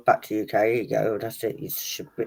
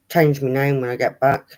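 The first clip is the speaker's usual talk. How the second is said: normal talking voice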